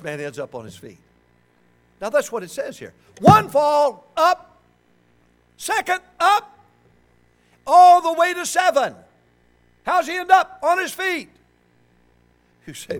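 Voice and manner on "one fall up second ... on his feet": loud, high shouted sermon; full-force preaching. Real, not acted. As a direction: drive the points home at a preacher's shout.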